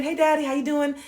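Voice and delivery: high, bright hello to a parent; light, upbeat singsong greeting; cheery greeting. Retold or imitated. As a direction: breeze in sweetly as if nothing happened. Cheerful greeting to dad, retold.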